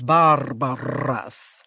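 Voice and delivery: "barbarous" with both R's heavily trilled; rolled R's. A narrator's reading. Trader trying the lady's accent, both R's rolled phenomenally. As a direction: draw out "barbarous", rolling each R hard and loud. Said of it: "He rolled both R's phenomenally".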